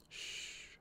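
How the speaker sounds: soft whispered shush; hushing. A narrator's reading. She comforts her crying friend, whispered shush. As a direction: hush him gently. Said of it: "she said".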